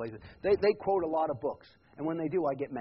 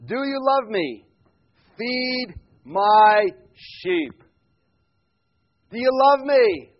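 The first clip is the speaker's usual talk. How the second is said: Jesus asking Peter; 8 semitones higher, 14 dB louder, much slower than usual. Acted. Preacher voicing Jesus to Peter.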